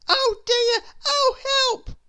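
high, loud panicked wail; boy calling for help in fear; frightened cry for help. A narrator's reading. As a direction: cry out in terror. Jack about to be eaten.

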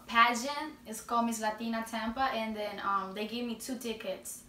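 ordinary talk, nothing marked

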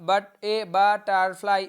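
butterfly slow and loud, as ba-tar-fly; teacher sounding out butterfly syllable by syllable; broken into syllables. Shown as a demonstration. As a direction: split butterfly into separate, forceful beats. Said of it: "এখানে দেখুন syllable ভাঙা আছে".

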